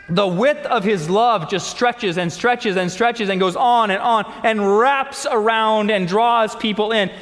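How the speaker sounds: ordinary talk, nothing marked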